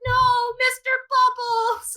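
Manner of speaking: high, slow, loud mock wail; upset kid. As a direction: wail it like a heartbroken child, hammed up. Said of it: "He's so upset".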